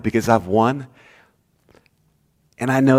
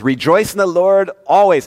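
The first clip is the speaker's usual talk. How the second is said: higher, ringing proclamation; Paul quoted; 7 semitones higher, 4 dB louder than usual. Acted. Pastor voicing Paul to the congregation, higher and ringing. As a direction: lift "always" up high and hold it. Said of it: "Paul says".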